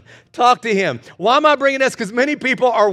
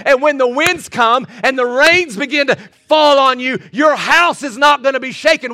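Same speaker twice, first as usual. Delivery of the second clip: rising, louder preacher's call; preaching; 4 semitones higher, 3 dB louder than usual. Acted. Pastor urging the congregation, preaching full force. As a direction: preach it out, building up.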